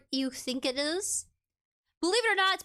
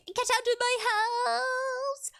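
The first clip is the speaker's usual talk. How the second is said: mock eviction yell; 9 semitones higher, slower than usual. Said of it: "I'm like".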